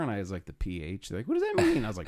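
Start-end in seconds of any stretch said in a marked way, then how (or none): 0.5-1.1 softer, lower embarrassed answer; mumbled self-conscious reply; sheepish mumble. Retold or imitated. His own sheepish answer. 1.3-1.8 much higher mock-confused question; high squeaky mock confusion; confused asker. People asking, confused.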